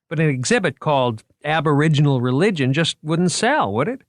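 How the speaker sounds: eager curator. A narrator's reading. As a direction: sell the exhibit with boyish enthusiasm. Moriarty pleads for the title.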